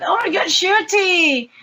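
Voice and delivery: high-pitched thrilled retold exclamation; excited fan cry. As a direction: gush his name like a starstruck fan. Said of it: "and we say".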